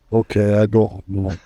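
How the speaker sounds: put-on a Saudi pilot signing off, lightly accented. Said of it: "Saudi accent".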